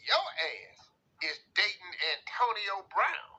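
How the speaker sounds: ordinary delivery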